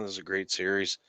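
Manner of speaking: normal talking voice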